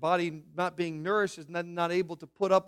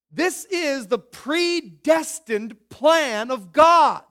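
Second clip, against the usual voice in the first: higher, louder, slow thunderous preaching; preaching at full force; 7 semitones higher, 9 dB louder, slower than usual. Acted. Preacher declaring God's fixed plan to Pharaoh, thundering slowly. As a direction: proclaim it as if to Pharaoh's face.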